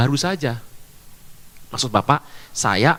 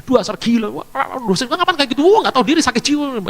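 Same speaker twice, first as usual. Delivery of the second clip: mock cursing; 7 semitones higher than usual. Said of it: "dia maki-maki saya".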